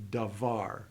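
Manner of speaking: "davar" with the accent on VAR; stress on final syllable. Shown as a demonstration. Teacher models Hebrew accent on the word's end, stress on VAR in "davar". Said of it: "elevate your voice at the end of the word".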